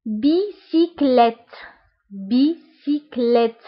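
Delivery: بيسيكليت said very slowly, syllable by syllable; slow model word. Shown as a demonstration. Teacher modelling a French word for learners, slow. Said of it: "هادو اللي بالاحمر هما لي فوايل".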